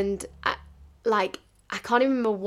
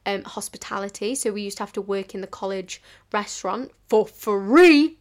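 ordinary talk, nothing marked

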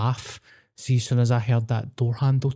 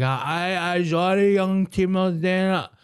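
higher, sheepish imitation; 5 semitones higher, slower than usual. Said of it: "And he's like".

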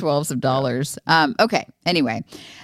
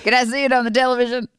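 confused dad impression; 9 semitones higher, much faster than usual.

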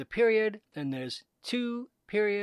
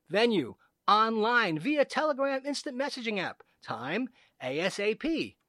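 mock formal reading; 3 semitones higher, 3 dB louder than usual. Reads scam email mock-formally.

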